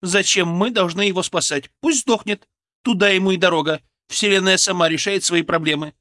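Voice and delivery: high, loud, scornful protest; contemptuous. A narrator's reading. Woman refusing to save the patient, contemptuous. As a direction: throw it out with disdain. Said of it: "исказилось гримасой презрения".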